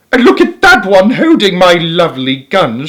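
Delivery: a husband shouting in outrage; shouted. A narrator's reading. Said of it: "shouted Mister Gregg".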